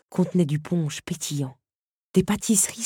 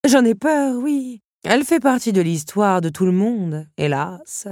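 high, loud admission that sinks to a sigh of regret; rueful countess; 5 semitones higher, 5 dB louder than usual. Acted. Countess telling Belle about the madwoman, ruefully dramatic. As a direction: admit it theatrically, then let it fall.

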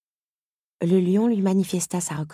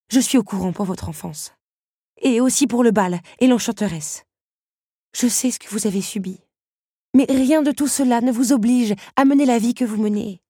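much higher, louder impassioned voice; passionate plea; 9 semitones higher, 7 dB louder than usual. Acted. Belle tells the beast his past is no excuse, impassioned. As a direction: build the conviction to the end.

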